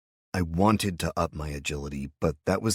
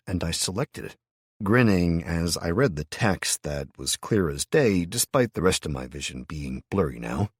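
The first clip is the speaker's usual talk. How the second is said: usual speaking voice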